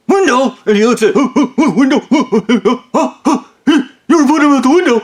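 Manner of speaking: high, loud huffing and sputtering; hammed-up incredulous manager, choking on each word; sputtering disbelief. Manager reacts to coffees going out the window, sputtering disbelief.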